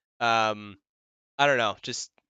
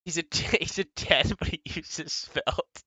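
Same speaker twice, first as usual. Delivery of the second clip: higher-pitched, breaking into laughter mid-phrase; cracking up; 4 semitones higher, slower than usual. Real, not acted. Streamer riffing on a ten joke, cracking up. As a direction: struggle to get the joke out through laughter.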